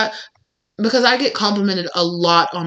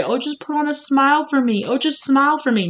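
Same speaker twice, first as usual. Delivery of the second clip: higher-pitched mocking plea; mock coaxing; 5 semitones higher than usual. Mimicking what no woman wants to hear, mock coaxing. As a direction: imitate someone wheedling for a smile.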